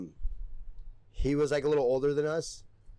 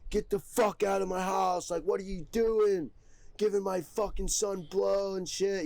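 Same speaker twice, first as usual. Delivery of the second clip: higher, mock furious father kicking him out; angry dad yelling; 6 semitones higher than usual. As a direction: play the pissed-off dad busting the kids. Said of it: "He's so pissed".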